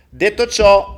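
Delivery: loud, high call-out; announcing. Real, not acted. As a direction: sing it out to the room.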